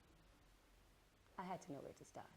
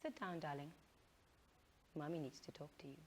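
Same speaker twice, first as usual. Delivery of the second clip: gentle mother; 6 semitones lower, 12 dB quieter than usual. Acted. Mother gently calling son.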